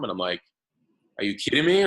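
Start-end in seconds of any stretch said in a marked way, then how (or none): 1.2-1.8 pitched up and louder, retelling his own shock; hearing about the huge platform, in mock disbelief; disbelieving. To the advisor about his famous site, mock disbelief.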